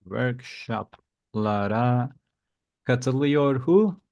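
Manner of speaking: very slow, drawn-out teacher prompt. Shown as a demonstration. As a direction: stretch it out to cue the learner. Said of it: "teacher prompts correction slowly".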